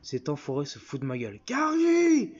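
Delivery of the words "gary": high, loud, drawn-out yell; angry call. Acted. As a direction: holler the name across the house. Wife yells for her husband.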